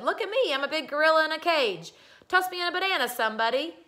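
put-on high, loud boyish clowning; show-off call. Boy plays caged gorilla at dinner, showing off loudly.

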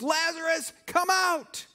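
calling high and loud into the tomb; loud shout. Retold or imitated. Jesus shouts to Lazarus in the tomb, high loud call. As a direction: raise your voice, call him out. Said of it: "he shouted with a loud voice".

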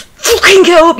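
high, loud furious yell; really angry. Real, not acted. Gamer to fallen character, angry yell. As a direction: explode at the character. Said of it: "I'm getting really angry".

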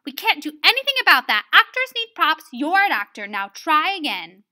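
loud, brisk no-nonsense order; harsh teacher. Acted. Miss Bunyan answering Robert's complaint, harsh and loud. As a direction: rattle it off loudly, brooking no excuse. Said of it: "I also learned that she's kind of harsh".

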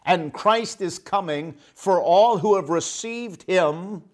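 usual speaking voice

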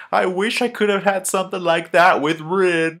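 high, whiny mock longing; hammed-up self-pity in a high voice; Obito, mock wistful. Obito thinking of Rin while hearing Hinata, mock wistful. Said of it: "I can't help but feel bad for him".

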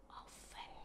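"Often" whispered, breath only. Shown as a demonstration. Teacher whispers "Often" as an echo for the class. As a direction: whisper "Often" back quietly. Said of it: "nhớ phát âm cuối".

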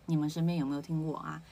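ordinary delivery

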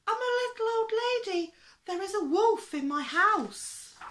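put-on very high, loud little old lady voice; crying for help. A narrator's reading. Little old lady phoning the zoo, crying wolf in a high voice. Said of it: "she cried".

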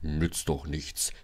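low, soft, slow grumble; sulky big-engine growl; grumbling. A narrator's reading. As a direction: mumble it gloomily, deep down. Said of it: "brummte Gordon".

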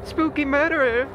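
ordinary delivery

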